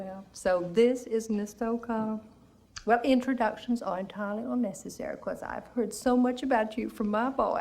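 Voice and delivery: ordinary talk, nothing marked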